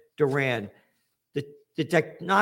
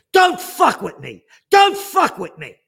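escalating snarl into a shout, much higher at the end; tough threat; 7 semitones higher, 9 dB louder than usual. Retold or imitated. Small kid warning off bullies, building to a shouted threat. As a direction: warn them off, louder the second time.

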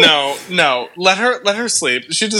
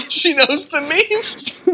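excited; 11 semitones higher, much slower than usual. Real, not acted. Excited, laughing delight.

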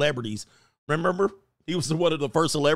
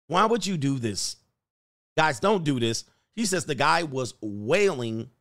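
usual speaking voice

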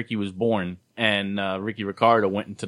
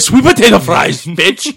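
loud and much higher mock order; hammed-up yell; 8 semitones higher, 9 dB louder than usual. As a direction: bark it like a cartoon tough guy. Mock Terminator ordering fries.

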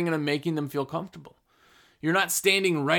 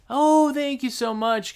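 higher, drawn-out grateful gush; effusive thanks; 8 semitones higher, 4 dB louder, slower than usual. Acted. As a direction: act out a delighted, slow thank-you. Acted grateful customer greeting.

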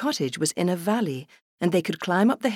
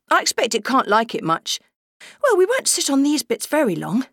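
put-on higher, young children talking; child voices; 8 semitones higher, 4 dB louder than usual. Siblings on the moor, lightly childlike. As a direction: play it as two small children.

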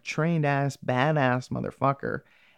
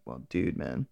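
low mock tough-guy voice; 14 semitones lower, 6 dB quieter than usual. Retelling the show, imitating the cowboy hero in a much lower voice. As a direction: growl it out lower like a too-cool gunslinger. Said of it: "And he's like".

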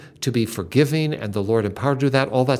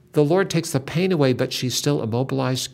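ordinary talk, nothing marked

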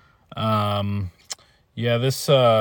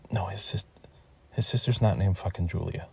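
whispering; 2 semitones lower, 9 dB quieter than usual. Real, not acted. Aside to someone in the room.

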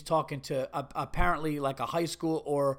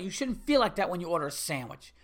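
usual speaking voice

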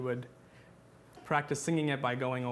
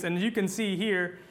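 ordinary talk, nothing marked

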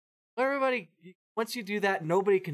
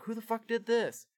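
higher, hot demand for a name; mock furious demand; 8 semitones higher than usual. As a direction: demand to know who did it. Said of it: "He's like".

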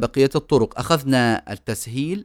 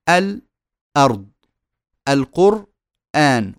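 الأرض and القرآن slow, halted before hamza; pause before hamza; much slower than usual. Shown as a demonstration. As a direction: break al. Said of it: "نسكت قبل الهمزة استعدادا".

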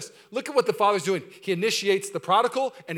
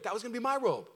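higher-pitched, indignant complaint; frustrated older brother; 7 semitones higher than usual. Acted. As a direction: protest the unfairness without shouting. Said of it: "justified why he's so frustrated".

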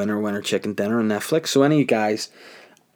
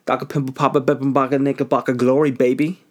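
Doctor Pimple Popper rattled off as a bouncy rhyme; tongue twister riff; 3 semitones higher than usual. Pretend. Riffing on the show's name, bouncy rhyme. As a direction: play with "Doctor Pimple Popper" like a playground chant. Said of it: "sounds like some sort of tongue twister".

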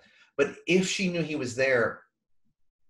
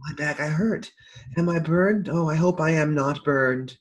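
imitating Chilton's whimper; 4 semitones higher than usual. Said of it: "What a weird way to say that".

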